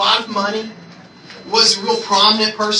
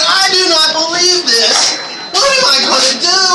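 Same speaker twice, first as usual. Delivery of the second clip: exasperated; 6 semitones higher than usual. Retold or imitated. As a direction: let the disbelief drag out. Upset on phone to wife.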